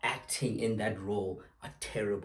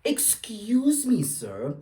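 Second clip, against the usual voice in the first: slow, loud and high with outrage; affronted excuse me, sir; indignant; 9 semitones higher, 6 dB louder, much slower than usual. Real, not acted. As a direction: hit excuse me in disbelief, drawn out. Indignant address to the pundit.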